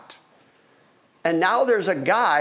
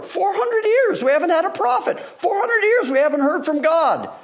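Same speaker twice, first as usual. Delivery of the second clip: much higher and louder, a catch of crying in the voice; preacher stressing four hundred years without a prophet, impassioned; impassioned emphasis; 12 semitones higher, 3 dB louder than usual. Real, not acted. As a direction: plead it with rising fervour. Preacher stressing centuries of silence.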